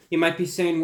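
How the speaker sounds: usual speaking voice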